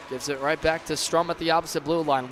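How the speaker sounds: ordinary talk, nothing marked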